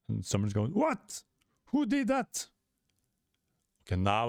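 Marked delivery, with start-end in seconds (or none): 0.7-2.5 high startled question; high astonished voice; shocked bystander. Acted. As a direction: gasp it out in disbelief.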